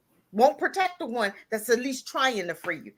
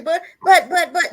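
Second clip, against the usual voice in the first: mocking stammer; 6 semitones higher, 7 dB louder than usual. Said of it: "They say".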